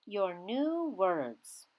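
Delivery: ordinary talk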